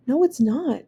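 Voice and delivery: plain reading voice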